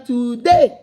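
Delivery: normal talking voice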